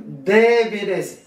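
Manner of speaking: "Débiles" slow, first syllable drawn out; stress on first syllable. Shown as a demonstration. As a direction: lean long on the DÉ of "Débiles". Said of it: "lettre est à un accent tonique". (« Débiles » en appuyant longuement sur le E)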